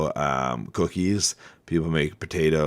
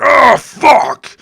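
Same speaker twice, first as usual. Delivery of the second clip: very high, loud shouted curse; acted shock shout; startled curse; 15 dB louder than usual. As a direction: blurt it out like you just got hurt. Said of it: "if somebody's like".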